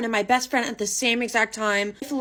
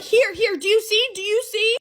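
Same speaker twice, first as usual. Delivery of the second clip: much higher and louder, urgent insistence; frantic; 11 semitones higher, 4 dB louder than usual. Retold or imitated. Showing her boyfriend photos of her friend, frantic. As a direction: shove the pictures at him and insist. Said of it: "And I'm like".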